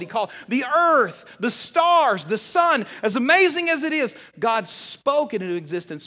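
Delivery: rising from steady to a loud, high preaching peak; building preaching. Acted. Pastor to congregation, building to full-force preaching. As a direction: build it up to a ringing climax.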